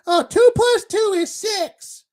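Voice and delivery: high, slow, loud mock kid voice; mocking a dumb kid. Mocking a kid who gets math wrong, high shouted mock child voice. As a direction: squeal it out like a clueless child. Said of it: "when a kid says".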